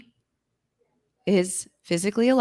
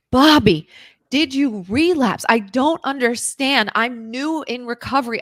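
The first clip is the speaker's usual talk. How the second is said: raised, high, louder plea; loud pleading; 5 semitones higher, 5 dB louder than usual. Retold or imitated. Confronting her sponsor friend, loud and pleading. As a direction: call his name out, desperate to understand.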